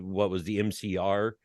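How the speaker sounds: ordinary talk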